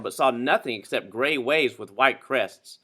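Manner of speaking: usual speaking voice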